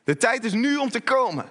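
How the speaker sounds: high, pressing call to move; urgent hurrying. Acted. Preacher as Jesus urges Zacchaeus down now, urgent hurrying. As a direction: hurry them along with insistent energy. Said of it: "Haast u, hij zegt niet".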